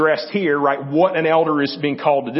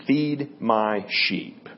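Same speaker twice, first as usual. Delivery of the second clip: very slow, softer, weight on Feed; slow solemn command; 6 semitones lower, 5 dB quieter, much slower than usual. Acted. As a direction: draw out the final command. Closing command of read passage.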